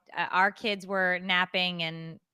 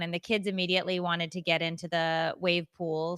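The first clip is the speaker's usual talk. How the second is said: ordinary talk, nothing marked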